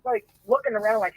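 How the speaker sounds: normal talking voice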